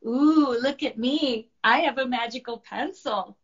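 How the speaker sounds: loud, playful show-off; playful monster teasing dragon. Acted. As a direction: wave it around and gloat. Monster teases with pencil.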